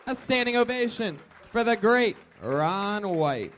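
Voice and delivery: loud, high hype-man shout; show announcer. Acted. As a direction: shout it over a cheering crowd, stretching the words. Hypes the ovation to viewers.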